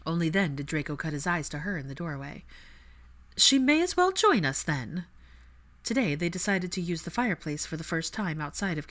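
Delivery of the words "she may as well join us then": high, louder haughty invitation; mock-posh invitation. Cool mother invites son's girlfriend, high and haughty. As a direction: lift it up, grandly arch. Narcissa invites Hermione pointedly.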